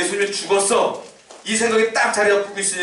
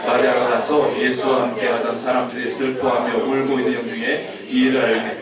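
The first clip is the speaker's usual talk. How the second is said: 낮은 목소리로 또박또박 성경 봉독; scripture reading; 7 semitones lower than usual. Acted. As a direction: read the verses low and measured, like a lector. (성경을 낮고 차분하게 낭독)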